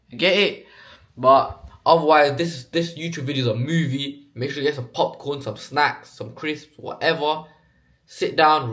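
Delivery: lightly put-on Bradford accent, innit-heavy; put-on Bradford accent, mostly in the lilt. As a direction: play a Bradford lad chatting to camera, a hint of the accent. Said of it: "me literally doing a Bradford accent".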